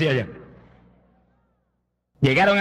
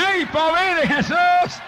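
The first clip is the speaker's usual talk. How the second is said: much higher, slow shouted praise; ecstatic shout; 10 semitones higher, slower than usual. Real, not acted. Preacher overcome, shouting praise to the congregation. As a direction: cry it out to the rafters.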